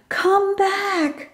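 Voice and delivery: high pleading call; a high desperate shout. A narrator's reading. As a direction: call the dog back from far away. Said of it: "she yells as loud as she can".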